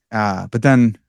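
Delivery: usual speaking voice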